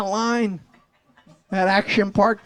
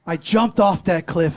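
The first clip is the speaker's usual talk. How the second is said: ordinary talk, nothing marked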